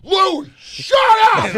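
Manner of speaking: high slow drawn-out shout at the dog; yelling at the dog. Retold or imitated. Owners yelling at the barking dog, mock shout. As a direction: holler the dog's name. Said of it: "all they would do is go".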